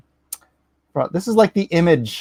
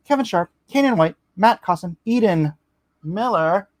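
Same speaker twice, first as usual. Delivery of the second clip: usual speaking voice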